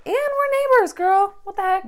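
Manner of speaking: high, loud squeal of disbelief; excited disbelief. Real, not acted. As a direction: shriek it at your friend in disbelief. Realizing they live next door.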